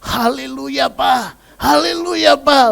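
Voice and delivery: high, loud, bright hallelujah; joyful greeting. Retold or imitated. New son-in-law greeting the pastor, high and joyful. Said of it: "Dan dikasih benar-benar yang".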